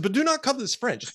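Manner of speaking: high, emphatic mock indignation; offended Frenchman. French boss objecting to the Canadian product, indignant. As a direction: imitate an excited, affronted French executive. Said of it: "when he got excited, his, his accent".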